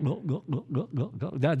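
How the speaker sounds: put-on baby gulping sounds. Pastor imitating a baby drinking its bottle, soft gulps. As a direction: mimic a hungry baby swallowing, hushed. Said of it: "I can't make the noise".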